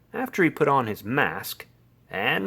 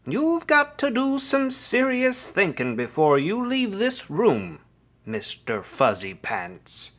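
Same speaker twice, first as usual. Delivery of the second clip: high-pitched motherly lecture, dropping at the end; lecturing; 7 semitones higher than usual. A narrator's reading. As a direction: lecture a kitten sternly, starting high. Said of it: "The lecture went on and on".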